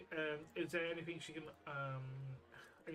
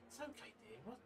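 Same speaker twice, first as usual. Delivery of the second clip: a soft, higher, tender voice for a crying stranger; gentle comforting; 8 semitones higher, 9 dB quieter, faster than usual. Acted. Trix softly comforts sobbing Frida, gentle and higher. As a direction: coax her gently, arm around her.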